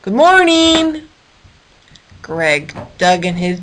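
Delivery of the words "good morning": slow, higher calling good morning. Acted. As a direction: lumber over and call out. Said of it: "called out again".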